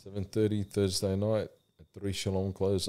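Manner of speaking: ordinary delivery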